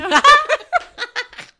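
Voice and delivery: loud, high real laughter. Real, not acted. Laughing at "just kidding.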